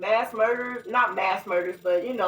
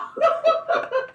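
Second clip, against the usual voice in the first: high burst of laughter; 4 dB louder than usual. Real, not acted. Telling friend about the shootout, high laugh. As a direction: crack up at the memory.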